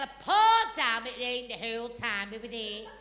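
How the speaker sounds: normal talking voice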